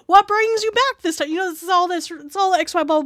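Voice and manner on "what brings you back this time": high, loud hotel-clerk welcome; overly cheery welcome. Retold or imitated. Clerk welcomes back a regular guest, high and loud. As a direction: pour on the fake cheer for a returning guest.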